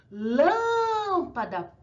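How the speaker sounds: lâmpada very slow, LÂM held high; stronger stressed syllable. Shown as a demonstration. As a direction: stretch the LÂM in "lâmpada", then fall away. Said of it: "o acento é a mais forte".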